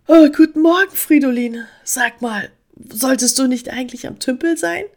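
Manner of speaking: puzzled question after a yawn; just woken squirrel. A narrator's reading. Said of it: "Emma gähnte laut". (noch leicht verschlafen, mit höherer Stimme)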